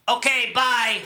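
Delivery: loud, abrupt imitated dad; dad's abrupt sign-off. Dad ending the call after a long pause, abrupt. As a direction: cut the call off loudly. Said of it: "then my dad goes".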